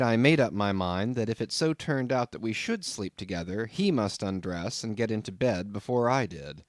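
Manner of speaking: plain reading voice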